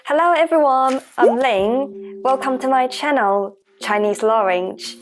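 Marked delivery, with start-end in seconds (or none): none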